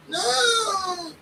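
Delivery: tiny high-pitched yelp of protest; high squeak. Retold or imitated. Cowering Ian squeaks at his bullies.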